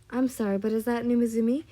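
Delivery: loud, quick disbelieving question; startled question. A narrator's reading. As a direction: blurt it out in surprise. Teacher startled to recognise the child.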